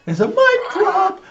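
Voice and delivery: high slow parrot imitation. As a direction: echo the toy bird's squeak. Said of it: "you do the voice for this guy".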